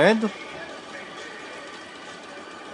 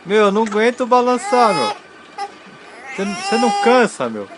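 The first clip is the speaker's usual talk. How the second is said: high, whiny mock protest; mock whiny complaint; 9 semitones higher than usual. Real, not acted. Father joking to baby that he's worn out. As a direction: whine about it up high, joking.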